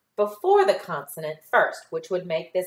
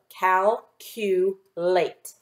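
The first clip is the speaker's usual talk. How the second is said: very slow "Calculate" split into its parts; slow syllable by syllable; much slower than usual. Shown as a demonstration. Teacher sounds out the word slowly for the class. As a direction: stretch each syllable before blending. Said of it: "Put those three syllables together".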